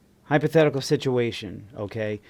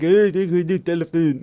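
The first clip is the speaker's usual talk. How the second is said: higher-pitched, badly faked French accent; English with French accent; 4 semitones higher, 3 dB louder than usual. Shown as a demonstration. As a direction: mime it out in pidgin mock French. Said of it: "speak English with a French accent".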